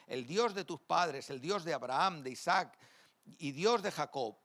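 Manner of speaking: loud, emphatic Bible reading to the congregation; scripture reading. Acted. Preacher reads Exodus aloud to the congregation, loud and emphatic. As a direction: proclaim the verses with full force.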